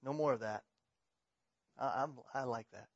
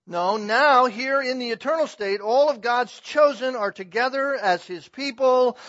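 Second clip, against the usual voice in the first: loud, raised-pitch pulpit proclamation; full-force preaching; 7 semitones higher, 15 dB louder than usual. Real, not acted. Preacher telling the church they are God's people, emphatic preaching. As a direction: drive it home to the congregation, loud and high.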